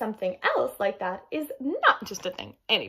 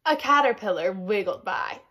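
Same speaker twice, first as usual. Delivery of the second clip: ordinary delivery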